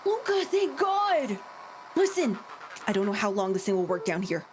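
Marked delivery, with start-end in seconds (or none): 0.1-2.4 relieved call. Acted. Relief over the radio.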